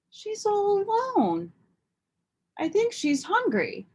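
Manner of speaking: put-on a small girl worried for a kitten; lightly voiced child, gentle concern; young girl voice. Esperanza speaks to kitten.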